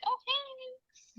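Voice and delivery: high, breathy-soft sweet greeting; customer service voice. Retold or imitated. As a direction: put on the polite phone voice. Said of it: "had to be all nice to people on the phone".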